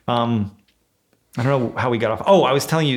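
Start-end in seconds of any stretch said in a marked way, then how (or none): none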